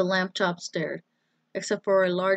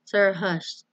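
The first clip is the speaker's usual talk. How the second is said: plain reading voice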